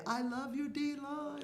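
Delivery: high, sweet call to the players; sing-song cheer. Retold or imitated. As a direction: sing it up high like a doting fan. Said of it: "And I would just say".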